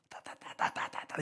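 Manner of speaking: whispered 따따따따따따따, quick and breathless; jaw speaking demo. Shown as a demonstration. Preacher whispers a demo of jaw tongues. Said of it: "턱을 잡는 방언".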